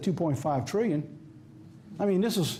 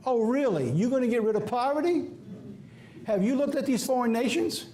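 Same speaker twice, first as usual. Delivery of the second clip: higher, scoffing disbelief; incredulous; 7 semitones higher than usual. Real, not acted. Preacher scoffing at the Pope's poverty plan, incredulous. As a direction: scoff at the idea, pitched up.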